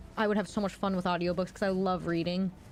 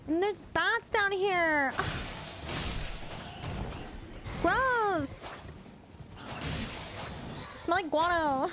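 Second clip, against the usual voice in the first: dumb blonde voice; 9 semitones higher than usual. Pretend. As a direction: whine like a giggly airhead. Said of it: "Someone redeemed dumb blonde voice ... talk like this".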